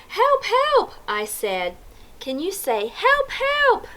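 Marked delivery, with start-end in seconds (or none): 0.1-0.9 high-pitched "Help, help"; cry for help. A narrator's reading. Boy phoning a policeman after the flood, high cry for help. 2.9-3.9 very high, squeaky call for help; cry for help. A narrator's reading. Narrator showing listeners what to say, high cry for help.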